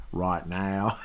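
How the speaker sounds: muddled Texan-Australian twang; short mock Texan-Australian drawl; Texas mixed with Australian. Shown as a demonstration. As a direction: echo a botched Texas-meets-Aussie accent. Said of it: "It was like Texas mixed with Australian".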